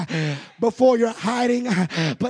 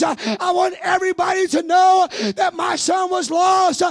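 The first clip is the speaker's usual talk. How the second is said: loud, high, building preacher's cry; full-force preaching; 6 semitones higher, 4 dB louder than usual. Acted. Preacher shouting to the congregation about the woman's joy.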